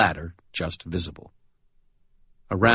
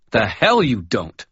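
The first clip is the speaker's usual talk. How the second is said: slow, hard retort; tough challenge; 5 semitones higher, 5 dB louder, slower than usual. Acted. Murphy calls the carney's bluff, slow and tough.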